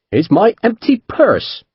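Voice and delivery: high, slow mock-grand captain. A narrator's reading. Captain explaining his authority is debt, grand and slow. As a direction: savour the joke grandly. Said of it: "Chase said grandly".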